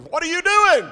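high, loud startled cry; shocked question. Retold or imitated. Preacher retelling his shock at the man cutting his cast. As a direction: blurt it out in disbelief.

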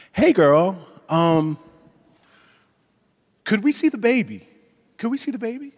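put-on impatient coworker sweetly asking to see the baby; polite hint. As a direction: nudge her toward the baby picture. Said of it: "you'd be like".

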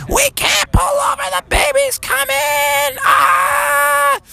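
very high falsetto shriek; stretched, hammed-up labor scream; Froggy's mom. Mom in labor answers police, frantic high shriek. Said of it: "And Froggy's mom said".

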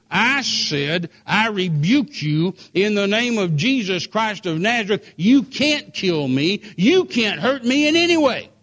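slow, booming rebuke that climbs to a high shout; bold rebuke. Retold or imitated. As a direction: thunder it at the man with the gun. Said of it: "I stuck my finger back in his face".

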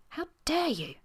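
high-pitched indignant gasp; gasped outrage. A narrator's reading. Woman wrathful at his audacity, gasped outrage. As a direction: gasp it out, pitch leaping in affront. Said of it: "and she gasped".

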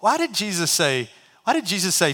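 ordinary talk

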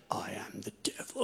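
low, hushed mock devil; a hint of a creepy devil; lightly hammed-up sinister voice. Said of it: "a creepy voice".

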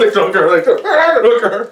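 laughing, high mock-frantic wail; mock panic. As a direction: play a panicking lawyer, half laughing. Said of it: "He's like".

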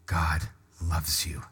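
intimate, gentle promise; tender. Real, not acted. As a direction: speak it straight to one person. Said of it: "look you in the eyes".

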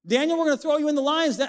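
high, fast taunting threat; mock threat. Preacher voicing Daniel's enemies, high fast taunt. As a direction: rattle off the threat like a bully.

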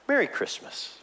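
whispered, deadpan; a wry, breathless aside after bad news; dry ironic whisper. Preacher wryly whispers to congregation after grim news, ironic.

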